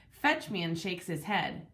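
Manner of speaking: ordinary delivery, nothing marked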